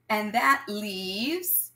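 drawn-out suspense. Acted. Suspense before the answer.

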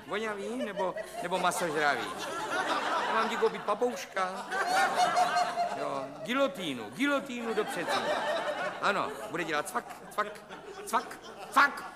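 high, giggling, unhinged mockery; manic laughter. Psychiatrist going mad on the phone, manic cackling laughter. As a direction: laugh madly through every word.

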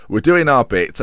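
usual speaking voice

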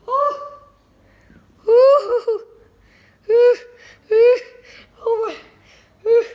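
high, loud oohs of alarm; startled whoops. Real, not acted. Vlogger watches the dangerous toy fly, whooping in alarm. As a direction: yelp in short high bursts.

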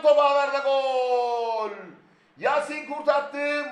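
drawn-out goal scream; screaming football commentator. Real, not acted. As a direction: roar the goal call. Goal call.

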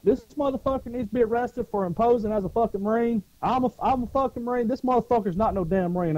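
high, heated accusation; retold indignant rant. Marine denounces an impostor to the cops, indignant.

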